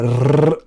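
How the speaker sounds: quick tongue trill "rrr"; rolled r trill. Shown as a demonstration. Coaching learners to practise the rolled r. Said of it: "entraînez-vous à rouler le R".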